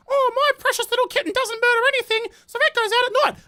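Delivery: very high, cooing mock pet-owner voice; mockingly sweet, high-pitched cat owner; doting cat owner. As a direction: gush in a silly high falsetto over a pet. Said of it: "if you have cats and you're like".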